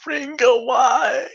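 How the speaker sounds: high, loud tearful cry of a name; pleading through mock tears; tearful plea. Character moved to tears.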